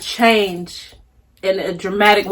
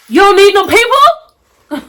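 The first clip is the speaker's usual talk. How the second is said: high emphatic declaration; 9 semitones higher, 3 dB louder, slower than usual. Real, not acted. Fired-up declaration.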